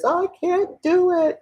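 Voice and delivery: high-pitched mock helplessness; self-defeated mock voice. Voicing someone blaming themselves.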